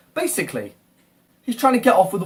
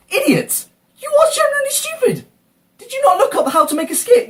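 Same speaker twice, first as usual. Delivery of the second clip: much higher, louder, yelling at the skit maker; real furious yelling; 4 dB louder than usual. Real, not acted. Ranting at the skit maker, furious yelling. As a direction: really lose it and shout insults. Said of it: "my fucking voice is gonna go".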